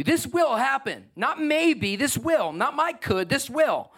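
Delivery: "will" hit high and firm each time; stress on will. Real, not acted. Pastor insisting to the church it will happen, emphatic. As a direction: punch "will" every time, rising. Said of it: "everybody say will".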